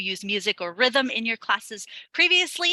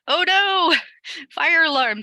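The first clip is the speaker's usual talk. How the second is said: sudden "oh no", higher and louder; startled as the alarm goes off; real surprise; 9 semitones higher, 7 dB louder than usual. Real, not acted. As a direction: caught off guard, blurted out. Fire alarm goes off mid-class.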